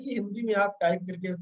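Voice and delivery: ordinary talk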